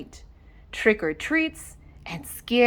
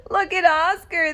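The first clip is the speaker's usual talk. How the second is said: mocking cry; 8 semitones higher than usual. Dogs jeering at Oscar in his bun, mocking cry. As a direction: point and jeer at him. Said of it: "they cried".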